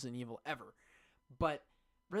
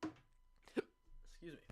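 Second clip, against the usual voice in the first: hiccuping; 2 semitones higher, 8 dB quieter, much slower than usual. Real, not acted. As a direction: caught by a hiccup mid-sentence. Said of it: "like, hiccuping now".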